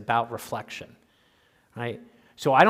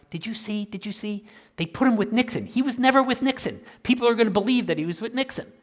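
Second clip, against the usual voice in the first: higher, agitated retelling of his own panic; a hammed-up worried rant; freaking out; 9 semitones higher than usual. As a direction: replay the outburst with mock alarm, pitch way up. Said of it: "and I'm freaking out".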